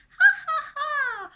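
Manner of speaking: acted ringing ha-ha; high loud belly laugh. A narrator's reading. Anna heard laughing by others, loud laugh. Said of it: "hear Anna laughing".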